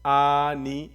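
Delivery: آنی with the first آ stretched long and loud; drawn-out aa. Shown as a demonstration. Teacher demonstrating to pupils, long آ in آنی. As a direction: lengthen the opening آ of آنی. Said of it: "اس کو کھینچ کر کے پڑھیں گے".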